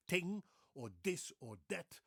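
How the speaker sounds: "ting or this or that" with every th hardened to t or d; Dutch-accented TH. Shown as a demonstration. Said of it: "very big problem, pro-, for, for instance, pronouncing the T H".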